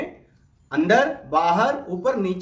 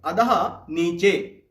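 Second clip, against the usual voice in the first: slow, careful teacher model of अधः for students to echo; slow repeat-after-me; 2 semitones lower, much slower than usual. Shown as a demonstration. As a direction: say अधः नीचे slowly and clearly, syllable by syllable. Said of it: "मेरे साथ साथ आप बोलिए".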